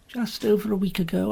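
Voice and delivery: ordinary delivery, nothing marked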